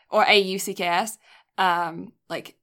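normal talking voice

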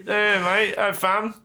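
high-pitched put-on British accent; hammed-up British lad; mock British slang. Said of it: "what do British people say instead of brother?".

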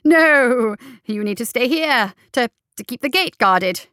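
high, loud burst, then stumbling on; flustered insistence, covering a slip; urgent. A narrator's reading. Doctor orders guardsmen to stay at the gate, urgently. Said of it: "rather more urgently than he intended".

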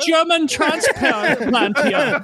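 slow, high hammed-up German accent with a laugh; joking German accent; mock German accent. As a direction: introduce yourself as a goofy German newcomer in a German accent. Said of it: "Why am I doing that accent?".

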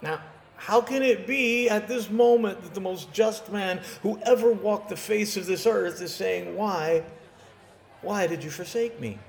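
put-on impassioned young preacher; rising preaching. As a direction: build the sermon with fervor. Preaching builds in passion.

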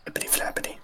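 barely voiced, very soft; whispered flutter. Imitating the book fluttering away from her, whispered. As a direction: whisper it like pages flapping.

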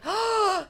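a hammed-up shocked gasp; dramatic gasp. Acting Cleo's shocked gasp.

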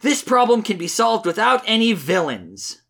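high, sing-song earnest Sonic. Acted. Reader voices Sonic defending his friend, high and earnest. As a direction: preach friendship in a high, naive hero voice. Reads Sonic's comic lines.

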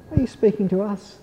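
high, quick, eager question; questioning animals. A narrator's reading. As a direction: ask it in small high voices. Animals timidly question God.

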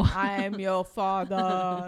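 slow, loud booming villain voice; Darth Vader. Retold or imitated. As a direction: boom it out slowly, menacing. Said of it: "starts talking like Darth Vader".

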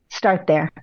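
ordinary delivery

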